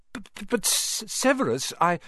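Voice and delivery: high, stammering, frightened Quirrell; nervous stutter. A narrator's reading. Quirrell stammering to Snape, high and nervous. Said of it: "he was stuttering worse than ever".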